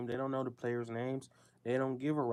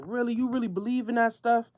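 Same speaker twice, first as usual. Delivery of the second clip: incredulous; 9 semitones higher, 7 dB louder than usual. Retold or imitated. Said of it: "Anton's like".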